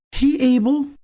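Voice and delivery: sing-song greeting. A narrator's reading. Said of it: "Damon practically sings".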